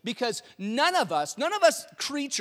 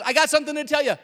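raised, higher voice over the noise; loud announcing; 8 semitones higher, 9 dB louder, much faster than usual. Acted. Angel trying to tell shepherds news, loud insisting. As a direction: holler it to a panicking group.

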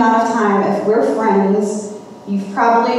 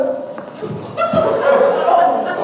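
high unvoiced giggle of a caught kid; giggle, caught red-handed; mischievous laugh; 12 semitones higher than usual. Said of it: "and then he goes".

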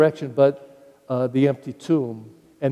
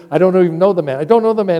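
louder, higher protest; heated denial; 4 semitones higher, 6 dB louder, much faster than usual. Acted. Peter denying he knows Jesus, heated and loud. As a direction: push him away with the words.

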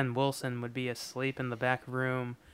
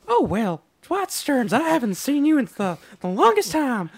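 put-on high, loud southern old man, fast; high-pitched southern accent; 10 semitones higher, 9 dB louder than usual. Fan imitating Mister Wilson greeting Dwight, high loud southern accent. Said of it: "that high-pitched southern accent".

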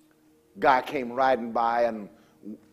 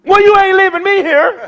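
much higher, louder laughing plea; excited plea; 14 dB louder than usual. Retold or imitated. Coworker to the preacher, loud high laughing plea. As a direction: beg not to be left behind.

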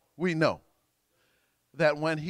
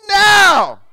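loud, high, forceful shout; like you mean it; 19 dB louder than usual. Shown as a demonstration. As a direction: shout it with full conviction. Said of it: "You gotta say it like you mean it".

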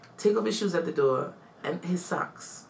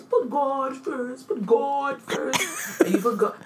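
slow, drawn-out, higher mock-solemn preaching of advice; imitating wedding guests handing out advice, slow and preachy; mock preachy advice-giver; 7 semitones higher, slower than usual. To the podcast host, mocking wedding advice in a slow preachy voice. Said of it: "they give you advice".

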